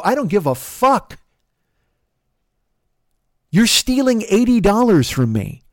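high, defiant angry retort; angry accusation. Retold or imitated. Driver answering passenger's one-star threat, angry accusation.

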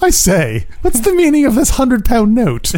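put-on high, indignant posh British accent. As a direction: sputter like an offended British toff. Posh British gent impression.

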